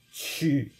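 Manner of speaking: Qu said high and falling, Mandarin fourth tone. Shown as a demonstration. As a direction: drop Qu sharply from high. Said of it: "will be said according to their current standard Mandarin".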